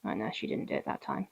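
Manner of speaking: fast, whispered side remark; whispered aside. Real, not acted. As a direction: slip it in under your breath as a quick aside. Quiet aside off mic.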